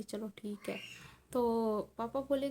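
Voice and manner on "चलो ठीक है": breathy whispered okay, barely voiced; whispered reply. Father agrees to eat, whispering. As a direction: answer in a hushed whisper.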